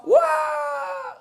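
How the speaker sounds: high yelped wah; a sudden high yelp as he flies; kid's flying yell. Retold or imitated. Kid flung off the merry-go-round, high yelp.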